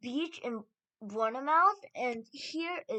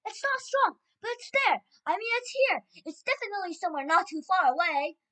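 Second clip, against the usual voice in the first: high, loud, urgent witch voice; excited shouting; 9 semitones higher, 8 dB louder than usual. A narrator's reading. First witch tracking the smell aloud, shouting. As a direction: shout each discovery at the room. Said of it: "shouted the first witch".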